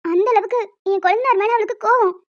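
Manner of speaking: ordinary delivery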